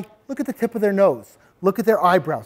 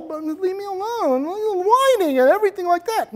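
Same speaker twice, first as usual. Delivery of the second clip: whiny mumble; 11 semitones higher than usual. Shown as a demonstration. Teacher showing kids what not to do, whining. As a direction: whimper it out weakly. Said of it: "You don't wanna mumble".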